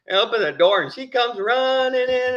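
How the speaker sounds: ordinary delivery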